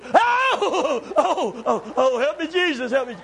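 laughing mock-frightened wail; mock panic cry. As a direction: play a boy yelping in fake terror. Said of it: "some guy over here goes".

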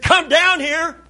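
high-pitched angry order; imitating crazed anger. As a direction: yell up the pole like a madman. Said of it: "He went crazy".

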